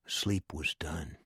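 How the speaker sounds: ordinary delivery, nothing marked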